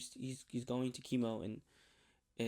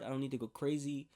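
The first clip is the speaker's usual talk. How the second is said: ordinary delivery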